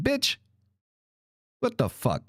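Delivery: ordinary talk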